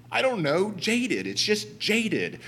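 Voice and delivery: high, slow, helpless repeat of jaded; flustered helpless repeat. Retold or imitated. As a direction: throw up your hands and repeat the word. Flustered reply to translator.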